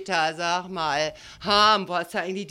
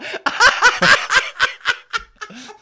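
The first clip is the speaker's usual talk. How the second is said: shrieking high laugh; loud high cackle; 9 dB louder than usual. Real, not acted.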